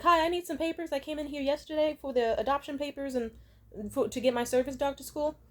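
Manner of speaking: a light, decently polite request. Retold or imitated. Friend asking the office lady politely for papers. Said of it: "she said it decently politely".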